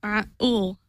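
normal talking voice

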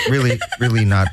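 ordinary talk

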